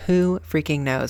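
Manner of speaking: normal talking voice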